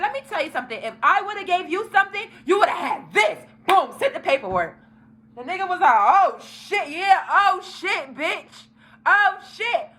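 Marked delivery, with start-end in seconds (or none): 0.0-3.4 retold indignant confrontation. 6.0-9.9 high, drawn-out astonished reaction; mimicked shock. Imitates boy's reaction to paperwork.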